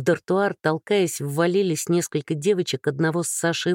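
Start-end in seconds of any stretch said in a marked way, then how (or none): none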